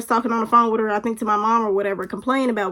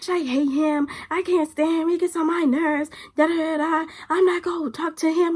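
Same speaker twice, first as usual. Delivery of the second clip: higher-pitched mocking imitation of a complaining friend; retold griping; 6 semitones higher than usual. Friend swearing off the boy, mock griping. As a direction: mimic her empty protests in a higher voice.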